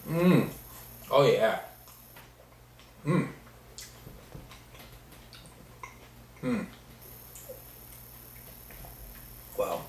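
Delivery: talking while chewing; mouth full. Real, not acted. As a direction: chew and talk through a bite. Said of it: "Sorry if I'm talking with my mouth full".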